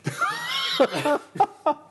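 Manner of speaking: sudden high, loud burst of laughter; shrieking laugh. Real, not acted. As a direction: crack up loudly at your own joke. Jokes about wrong movie.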